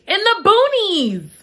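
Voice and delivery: high, slow dismay; slow, high mock horror; dramatic emphasis. Real, not acted. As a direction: stretch it out in disbelief. Dramatic repeat.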